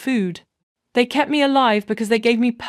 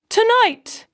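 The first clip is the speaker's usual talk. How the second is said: shouted much higher and louder; louder repeat; 13 semitones higher, 3 dB louder than usual. A narrator's reading. Husband orders his cheating wife out, shouting. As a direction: hurl the word back at her, higher and louder. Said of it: "he repeated, louder now".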